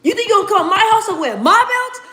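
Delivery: high, rising challenge; Ryan Garcia taunt. Retold or imitated. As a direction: dare him to take the belts. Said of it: "He said".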